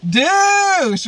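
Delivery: high, loud playful yell; mock shouted insult. Calling out to Tommy arriving.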